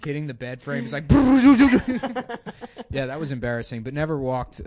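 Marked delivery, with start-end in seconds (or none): 1.1-2.8 loud, high sputtering buzz; vibrator buzz imitation. Massager banging the bed frame, loud imitated rattle. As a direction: rattle like a motor on wood.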